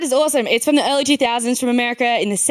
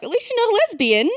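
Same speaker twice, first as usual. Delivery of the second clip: mock mum; 8 semitones higher than usual. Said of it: "my mum was like".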